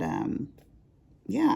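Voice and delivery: usual speaking voice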